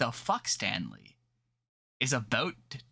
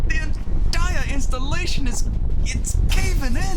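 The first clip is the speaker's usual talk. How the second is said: much louder and higher, frantic Frenchman; panicked shout; 11 semitones higher, 13 dB louder, slower than usual. Acted. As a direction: yell in terror as the earth gives way.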